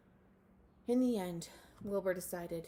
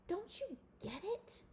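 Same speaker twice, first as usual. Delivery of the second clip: exasperated mole rats; 12 semitones higher, 3 dB quieter than usual. A narrator's reading. Mole rats scolding Wilbur.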